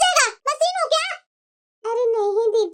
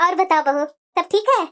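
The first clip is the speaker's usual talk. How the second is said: lower, sharp-tongued granny voice; old grandmother; 7 semitones lower than usual. Acted. Grandmother talking to her daughter-in-law, low and sharp. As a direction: play a scolding old grandmother.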